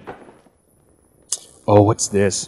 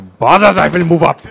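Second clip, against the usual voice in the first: put-on German accent; 4 semitones higher, 4 dB louder than usual. Mock German persona.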